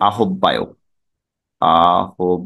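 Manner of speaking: আহ্বায়ক said as a-hob-bayok; broken-up a-hob-ba-yok; mistaken pronunciation. Shown as a demonstration. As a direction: sound h and double b in আহ্বায়ক. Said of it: "আমরা কি উচ্চারণ করি".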